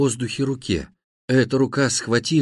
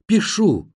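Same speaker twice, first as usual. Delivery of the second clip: high, raised answer; mild high shout; shouted reply; 7 semitones higher than usual. A narrator's reading. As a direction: call it out to someone hard of hearing. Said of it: "закричал я".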